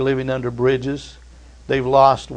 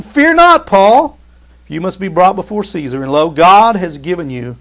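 higher and louder, slowed on key words; preaching at full force; 4 semitones higher, 5 dB louder than usual. Acted. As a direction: build the room up to a shout of hope. Preaching climax, raised voice.

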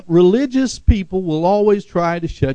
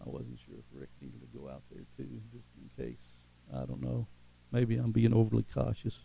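quiet aside; 5 semitones lower, 16 dB quieter than usual. Real, not acted. Aside off mic to usher.

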